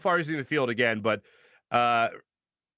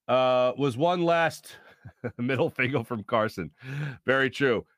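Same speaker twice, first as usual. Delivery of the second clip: normal talking voice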